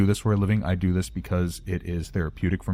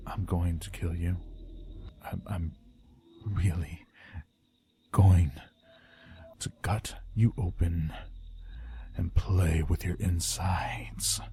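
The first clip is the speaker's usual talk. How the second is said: low, menacing threat that sinks into a whisper; evil villain voice; 3 semitones lower, 7 dB quieter, slower than usual. Shown as a demonstration. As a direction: play the killer, quiet and creepy. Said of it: "You have the perfect evil villain voice".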